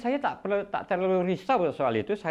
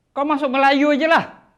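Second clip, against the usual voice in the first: retold angry father, higher and louder; 10 semitones higher, 7 dB louder than usual. Father scolding his son to just become Malay, loud and cross. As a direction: snap it like a cross father laying down the law. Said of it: "kalau bapak saya ni marah".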